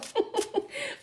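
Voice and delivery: very high little giggle; real squeaky burst of laughter. Real, not acted. Amused after the reading.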